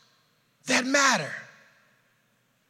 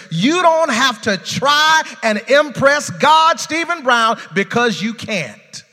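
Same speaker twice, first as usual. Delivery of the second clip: rising preacher's call, louder and higher; preaching build; 7 semitones higher, 7 dB louder, slower than usual. Real, not acted. Pastor urging the congregation, preaching loud.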